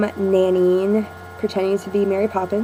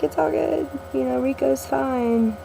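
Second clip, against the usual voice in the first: high, soft soothing voice; retold reassurance; 5 semitones higher, 6 dB quieter than usual. Nanny brings crying kid inside, retold reassurance. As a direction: softly reassure a small child, pitched up.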